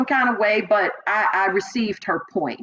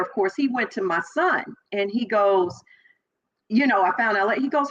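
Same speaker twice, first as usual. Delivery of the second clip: ordinary talk, nothing marked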